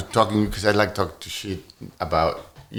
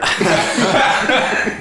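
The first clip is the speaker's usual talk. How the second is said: big real laugh; loud laugh; 9 semitones higher, 3 dB louder than usual. Real, not acted. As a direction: burst out laughing.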